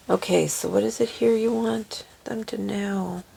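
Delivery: soft whisper asking the cards. Real, not acted.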